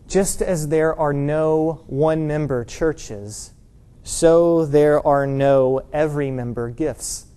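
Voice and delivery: very slow, measured delivery; slow deliberate quote. Retold or imitated. Preacher quoting an author to the congregation, slow and deliberate. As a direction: spell out the saying slowly and evenly.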